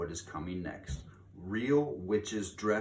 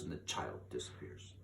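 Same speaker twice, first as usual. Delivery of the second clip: sudden unvoiced whisper as the child vanishes; 9 semitones lower, 12 dB quieter than usual. A narrator's reading. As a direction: drop to a hushed whisper. Climax of the swoop.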